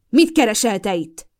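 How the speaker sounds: loud furious yell; shouted angry demand. A narrator's reading. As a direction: roar the question at him. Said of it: "ordította".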